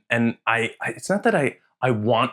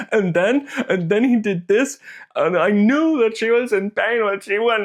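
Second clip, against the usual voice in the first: gushing; 10 semitones higher, 3 dB louder than usual. Retold or imitated. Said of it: "that's what's gonna happen if I talk about it".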